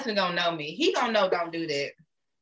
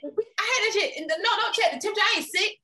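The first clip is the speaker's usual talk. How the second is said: laughing high rant; 11 semitones higher, faster than usual. Real, not acted.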